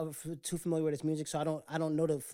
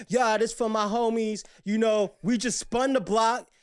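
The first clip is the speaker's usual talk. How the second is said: louder, put-on street voice for the camera; social media persona; 3 semitones higher, 8 dB louder than usual. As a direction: play it up like a tough online persona. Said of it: "I get on social media and I be like".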